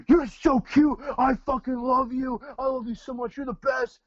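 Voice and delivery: high, loud exaggerated voice that sounds harsh; sweet words squealed in a scary tone; harsh tone, kind words. Dog owner squeals praise at his scared dog, harsh shrill tone. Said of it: "positive things in a negative tone".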